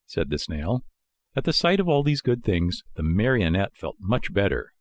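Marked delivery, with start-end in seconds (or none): none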